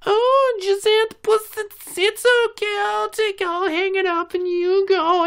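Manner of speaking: high falsetto baby-talk mockery; very high mocking coo to the spoiled kid; mock doting mother. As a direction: coo it like a doting mom, sneering.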